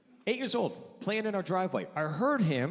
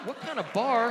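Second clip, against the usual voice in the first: slightly raised, playful put-on voice; lightly campy voice; 4 semitones higher than usual. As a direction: give the can a hint of camp. The can talks in joke.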